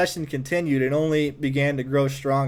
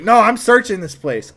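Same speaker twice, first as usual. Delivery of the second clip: put-on louder, higher, a kid insisting he'll search; firm raised voice, not backing down; insistent; 7 semitones higher, 6 dB louder than usual. Young Paul insisting to older boys, raised and firm.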